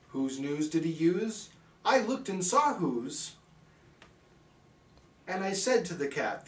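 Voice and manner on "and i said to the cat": ordinary delivery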